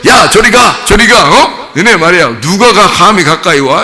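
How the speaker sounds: high-pitched, self-important telling-off; bossy scolding. Acted. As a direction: act out shooing children with borrowed authority. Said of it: "자기 힘 좀 과시한 거죠". (권세 부리듯 높게 꾸짖는 연기)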